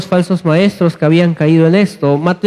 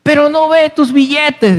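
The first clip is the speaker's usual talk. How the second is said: much higher voice, yelling held back in volume; mock preacher's shout; 9 semitones higher than usual. Pastor mimicking a minister asking for banknotes, mock shouting. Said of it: "Estaba gritando".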